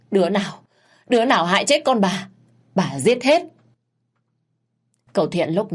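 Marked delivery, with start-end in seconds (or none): 0.1-3.4 higher, louder raging threats; furious screaming. Acted. Crazed mother threatening the killer, furious. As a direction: rage at whoever did it. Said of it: "Miệng thì gào thét".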